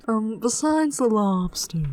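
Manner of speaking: drawn-out, slow accented voice; Maine accent voice. Shown as a demonstration. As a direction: stretch it out in the funny voice. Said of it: "I love s-, talking in that voice".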